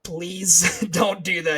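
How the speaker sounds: laughing plea. Retold or imitated. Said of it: "I'm like".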